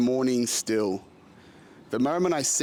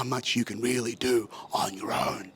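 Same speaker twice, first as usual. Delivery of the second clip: whispered taunt; the universe whispering a sly threat; menacing whisper. Retold or imitated. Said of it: "It's like".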